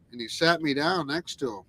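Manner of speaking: ordinary talk, nothing marked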